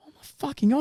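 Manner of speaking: high-pitched appalled reaction; appalled disbelief. Retold or imitated. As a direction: act aghast without raising the volume. Appalled onlooker retold.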